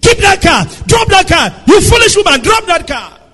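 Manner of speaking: high, loud shouted orders at his wife; retold pastor's scream. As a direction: bark it like a furious husband. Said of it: "is screaming".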